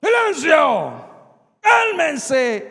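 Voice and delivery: loud, high, slow thundering command; Jesus silencing the storm, thundering; commanding shout. Acted. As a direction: bellow the order at the storm. Jesus rises and rebukes storm.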